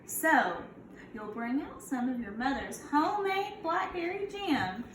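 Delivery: put-on storybook read-aloud for kids; a gentle teacher reading to her class; reading to children. Teacher reads picture book aloud.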